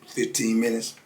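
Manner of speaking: ordinary talk, nothing marked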